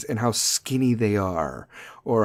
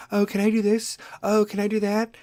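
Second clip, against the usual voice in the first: put-on begging teen voice; 7 semitones higher than usual. Daughter begging for money.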